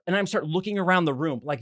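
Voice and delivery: ordinary talk, nothing marked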